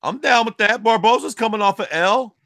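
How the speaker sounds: high and emphatic; high insistent Barboza pitch; excited pitch. Real, not acted. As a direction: push for the matchup. Pushing matchup.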